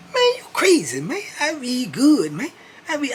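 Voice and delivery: very high put-on voice, indignant; Keith insisting. Keith insisting he reads fine, high and indignant. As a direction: insist loudly in a squeaky voice.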